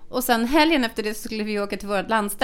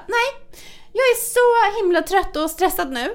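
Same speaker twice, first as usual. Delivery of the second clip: imitating him higher, whining that he's so tired; mock whiny husband; 7 semitones higher than usual. Imitating the husband to the friend, whiny and higher. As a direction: ham up his put-upon complaining. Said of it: "Oscarsvärdig insats av lur".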